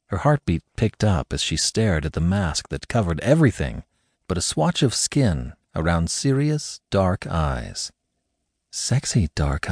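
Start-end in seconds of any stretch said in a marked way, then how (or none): none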